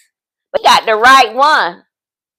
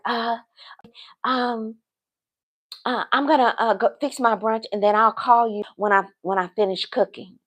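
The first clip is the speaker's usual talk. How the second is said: very soft, halting imitation of him; hesitant backing off; 2 semitones lower, 10 dB quieter than usual. Man promising to call after brunch, hesitant and hushed. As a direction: stumble quietly through the excuse. Retelling his awkward backing off.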